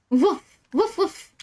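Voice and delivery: playful rising woof; woof mimicry. Shown as a demonstration. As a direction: bounce woof up like a dog. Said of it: "what you hear is woof".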